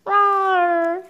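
playful high monster growl; monster roar. Acted. Monster in comic.

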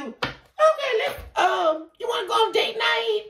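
high, drawn-out eager pitch; lightly hammed-up hopeful partner; hopeful date-night ask. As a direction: coax them sweetly toward a date, high and slow. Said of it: "let's say you".

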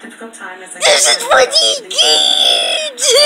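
drawn-out playful yell; slow shout. Pretend. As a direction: yell it out slowly.

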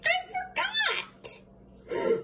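put-on very high, slow childish wail. David excuses himself to teacher, high slow wail. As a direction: stretch it out like a caught kid.

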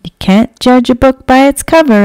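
loud, fast declaration; wise proverb. Acted. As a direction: announce it like a proud proverb. Edna's wise saying, loud.

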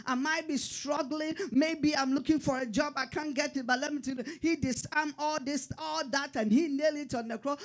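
rising, ringing sermon delivery; full-force gospel preaching; fervent preaching. Acted. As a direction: preach it to the back row.

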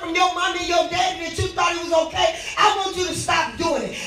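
shouting preacher building fervor; hollering revival preacher. Real, not acted.

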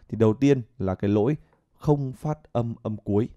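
normal talking voice

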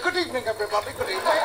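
high-pitched comic character voice; put-on high voice rising further; Quasimodo. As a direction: greet the audience in a high, odd voice. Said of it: "Quasimodo went on Mastermind".

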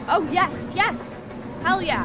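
loud victorious whoops; celebrating a win; triumphant cheer. Real, not acted. Beat the scorpion.

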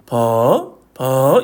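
퍼 dipping low and rising; question-mark tone. Shown as a demonstration. Teacher combining word and tone, 퍼 curling upward. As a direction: add a questioning upturn to 퍼. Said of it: "퍼에다가 성조를 합치니까".

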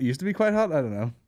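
ordinary talk

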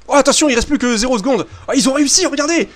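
high-pitched, excited last-second alert; excited play reaction. Real, not acted. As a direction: call it out high, thrilled by the buzzer shot. Panier à la dernière seconde. (exclamation excitée, voix qui monte)

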